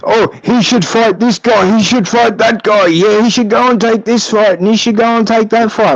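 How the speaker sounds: mocking the channels. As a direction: parrot the boxing channels' line. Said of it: "all the channels".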